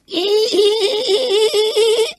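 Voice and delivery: very high, loud cackling laugh; shrill cackle. Acted. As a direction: let out a wild, piercing cackle. Teddy laughs at the game.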